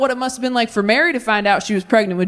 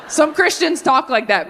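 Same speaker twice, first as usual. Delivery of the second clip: ordinary delivery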